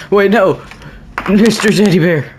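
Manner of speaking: panicked shout for his toy; alarmed cry. Real, not acted. Player crying out as the teddy bear is taken, alarmed.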